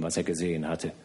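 ordinary delivery

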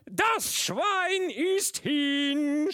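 very high, very loud and very slow; gleeful shout. A narrator's reading. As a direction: crow it out in drunken triumph. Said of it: "schrie er auf Ungarisch".

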